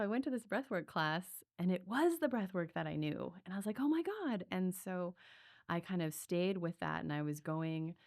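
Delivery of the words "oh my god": pitched way up in happy disbelief; a sudden delighted Oh my God; amazed gasp. Retold or imitated. Retelling recognizing the breathwork at a festival, amazed. Realizing it was familiar breathwork.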